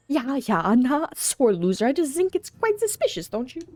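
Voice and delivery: suspicious rival with a put-on German accent; grumbling rival farmer.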